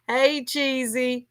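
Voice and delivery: ordinary delivery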